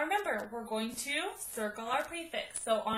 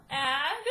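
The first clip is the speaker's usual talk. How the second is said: Ag called out alone, louder; syllable called out; 6 dB louder than usual. Shown as a demonstration. Teacher to students writing syllables, Ag called out louder. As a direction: say Ag on its own as one syllable. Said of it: "second syllable".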